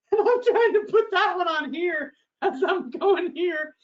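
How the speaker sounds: high-pitched, laughing comic fluster; laughing frantically. Real, not acted. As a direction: tell it while cracking up, voice shooting up. Laughing through retold frantic juggling.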